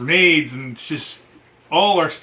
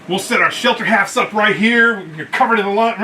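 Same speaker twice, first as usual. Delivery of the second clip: higher and louder, hard-charging Marine; gung-ho staff sergeant; 5 semitones higher, 5 dB louder than usual. Retold or imitated. Staff sergeant to the colonel, gung-ho and loud. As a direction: bark it out like a proud sergeant.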